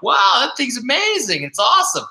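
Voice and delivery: high-pitched mock-excited wow; acted amazement. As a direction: play up being impressed, pitched well up. Acted reaction to patient's used motorcycle.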